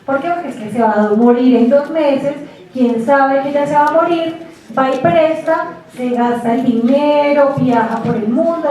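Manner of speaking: usual speaking voice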